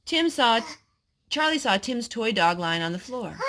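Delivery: usual speaking voice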